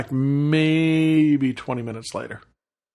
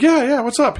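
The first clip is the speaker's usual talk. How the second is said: eager reply; 11 semitones higher, 4 dB louder than usual. Retold or imitated.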